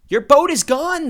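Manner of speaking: high, loud cry of alarm; the villagers cry out in shock. A narrator's reading. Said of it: "they cried".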